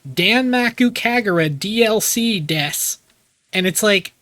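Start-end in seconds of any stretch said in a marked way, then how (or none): 0.1-3.0 exaggerated slow, higher title call; hammed-up, stretched title announcement. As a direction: imitate an overdone announcer voice. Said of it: "intentionally does the voice even more".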